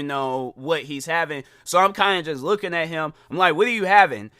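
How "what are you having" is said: louder, higher, laughing challenge; mock-impatient counter worker; pointed question. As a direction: ask it loudly over someone's phone call. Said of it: "I said it like that".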